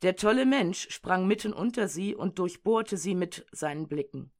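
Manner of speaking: normal talking voice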